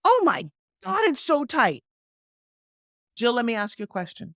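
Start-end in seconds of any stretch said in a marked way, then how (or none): 0.1-1.8 high-pitched delighted exclamation; acted amazement. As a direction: play a thrilled customer seeing results. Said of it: "you're gonna go".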